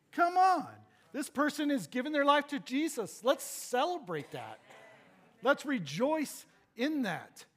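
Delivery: rally the church to rejoice; preacher urging the church to celebrate, fired up; fired-up preaching. Real, not acted.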